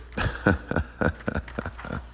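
long unvoiced chuckling; real laugh. Real, not acted. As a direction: let a wheezy laugh run on. Laughs after addressing the viewers.

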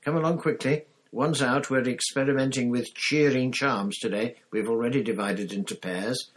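a tiny eager teacher calling the class in; Professor Flitwick. Acted.

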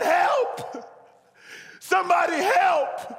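very high, drawn-out shout; desperate cry for help. Acted. Preacher as man dangling off a cliff, crying for help. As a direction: holler up for rescue at full volume.

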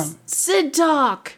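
lightly doing the crazy guitar player calling her Sid Talk; imitating college friend. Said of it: "So he said".

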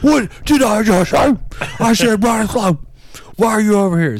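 high-pitched exasperated yelling; high, strained mock shouting; mock supervisor yell. Supervisor quoted losing patience, shouted.

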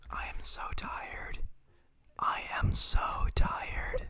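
fully whispered, drawn-out complaint. Pretend. Co-host saying he is tired, whispering. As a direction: whisper it slowly into the microphone. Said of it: "Is this loud?".

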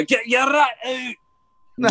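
slow, high Scottish yell; Scottish shout. Retold or imitated. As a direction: bellow it like a Glaswegian fan at a birdie.

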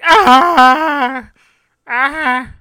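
loud, high mock yell; Pee Wee Herman yell. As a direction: let out a hammed-up Pee Wee Herman shriek. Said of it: "yelling like fucking Pee Wee Herman".

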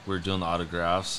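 usual speaking voice

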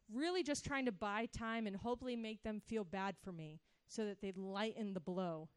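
usual speaking voice

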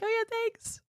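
high, soft offhand thanks; mock breezy thanks. Mocking her friend among sobbing people, high soft thanks. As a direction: toss off a light high thank-you. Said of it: "you're just like".

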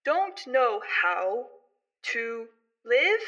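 slow, loud, drawn-out words; spaced word by word, stretched out; otherworldly construct. Acted. Fading construct's last flicker to the child, slow and halting. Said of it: "repeats what it said before".

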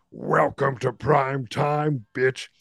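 slow, put-on monster villain voice; drawn-out villain catchphrase; Freddy Krueger. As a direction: draw it out like a taunting movie killer. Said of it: "It's Freddy's head".

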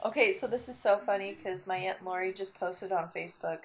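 ordinary talk, nothing marked

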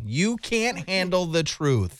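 "you can't handle the truth" stretched out slowly; over-enunciate "you can't handle the truth" for a lip-reader; very slow, deliberate "you can't handle the truth"; exaggerated mouthing. Shown as a demonstration. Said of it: "what you're doing with your lips".

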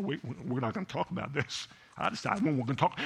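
soft, fast, half-joking protest; mock indignant retort. Pastor mimicking himself protesting to his wife, soft and fast. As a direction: rattle it off under your breath, mock offended. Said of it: "I was like".

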